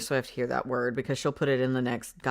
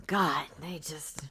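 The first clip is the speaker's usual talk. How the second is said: exasperated whisper under the breath; 8 dB quieter, slower than usual. Real, not acted. Reader whispering to viewers, fed up.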